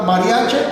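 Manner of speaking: "mariache" ending in a clear E; final vowel of "mariache" is E, not I. Shown as a demonstration. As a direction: say "mariache" as written, closing on E. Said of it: "está con E".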